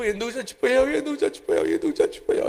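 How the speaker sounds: high, hammed-up sobbing cry; a mourner's exaggerated lament, fading to a breathy wail; mock wailing. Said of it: "ఇదే ఏడుపు".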